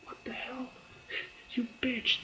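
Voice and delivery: high, startled cry sliding into a whispered insult; imitating Dodd getting stabbed; mock pained yelp. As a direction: Dodd yelping after Peggy stabs him. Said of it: "And she's like".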